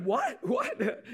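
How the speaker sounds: high, loud startled what; shocked double take, voice jumping up; mock friend's startled what what; stunned what.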